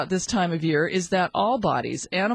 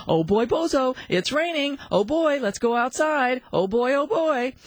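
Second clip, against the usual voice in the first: higher, excited party voice; jolly; 5 semitones higher than usual. Shown as a demonstration. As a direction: sell it like the party of the year. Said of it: "the jollier you must be".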